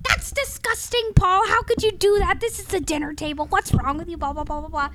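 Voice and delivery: high, loud whiny scolding voice; whiny ex impression. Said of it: "She's like".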